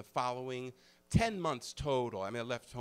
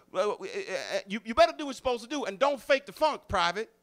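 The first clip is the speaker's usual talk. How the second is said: high, loud and fast barking drill sergeant; a fast, pumped-up sergeant impression; imitating a drill sergeant; 9 semitones higher, 6 dB louder than usual.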